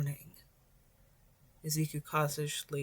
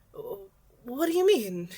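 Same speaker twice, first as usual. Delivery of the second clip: confused; 5 semitones higher, 5 dB louder, much slower than usual. A narrator's reading.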